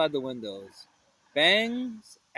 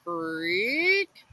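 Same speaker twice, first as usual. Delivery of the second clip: creak. A narrator's reading. Door creaking sound.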